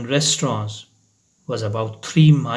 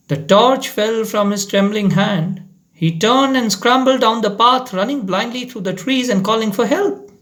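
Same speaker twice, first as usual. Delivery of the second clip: higher, faster panic telling; urgent narration; 7 semitones higher than usual. A narrator's reading. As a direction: race through the escape.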